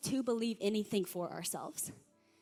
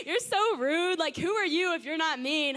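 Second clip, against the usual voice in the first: light mock scolding, a bit louder; playful teasing; 3 semitones higher, 3 dB louder than usual. Girls ribbing the mean girl, lightly mock scolding. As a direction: tease her like a friend. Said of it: "They're like".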